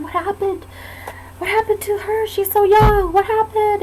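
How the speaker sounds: imitating worried onlookers, voice pitched high; alarmed bystanders. Onlookers asking about an injured young woman, alarmed.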